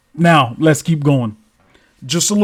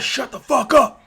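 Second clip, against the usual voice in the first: hushing command; 9 semitones higher than usual. Retold or imitated. Girl hushes the group.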